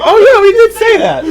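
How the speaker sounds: high squeaky laughing "oh yeah"; mock realization. Recalling the callback, laughing mock surprise. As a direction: act out the sudden memory with a laugh.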